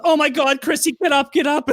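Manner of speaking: urgent high cry to get him up; Adriana panicking. Retold or imitated. Adriana finds the dog under Chrissy, high panicked plea. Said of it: "And she goes".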